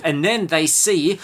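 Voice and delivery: ordinary talk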